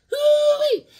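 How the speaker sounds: very high, loud "hoo-wee"; cowboy whoop. Pretend. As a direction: let out a rodeo-style whoop. Cowboy whoop for Western hero.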